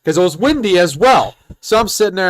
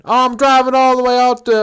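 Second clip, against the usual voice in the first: higher-pitched imitation country man; 7 semitones higher, 3 dB louder than usual. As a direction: answer in a put-on country twang. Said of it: "the country voice".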